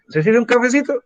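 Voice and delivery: playful mock host; Chilean host offering coffee. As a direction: sweetly offer a little coffee like a typical Chilean. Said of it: "El chileno".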